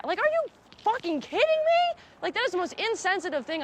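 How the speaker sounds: real indignation, voice jumping way high; outraged. Real, not acted. To the interviewer about her friends, outraged and high. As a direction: pitch leaps up in disbelief.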